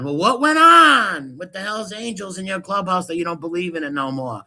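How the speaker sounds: usual speaking voice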